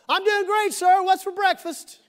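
high, loud, chipper reply; cheerful shout back. Acted. Daniel answering the king from the den, cheerful shout. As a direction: holler back up as if all is fine.

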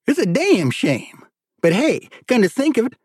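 high-voiced drawling judge; judge lamenting.

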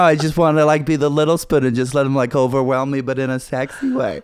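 put-on female American accent. American woman explaining her crush, put-on female accent. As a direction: gush as a mock American woman. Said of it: "I can only do female American accent".

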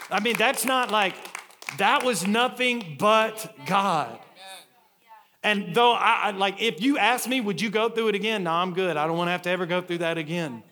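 loud, high, emphatic preacher delivery; full-force preaching. Real, not acted. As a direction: proclaim it to the whole congregation.

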